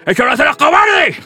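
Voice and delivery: high, forceful shout in a mock pep talk; impassioned rebuke. Boxer father lectures his son on courage, shouting passionately. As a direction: build to an indignant motivational yell. Acted motivational speech to a son.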